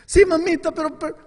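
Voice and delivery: high, loud begging; mock-tearful child begging; desperate child plea. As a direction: cry out like a frightened boy. Boy begging mother.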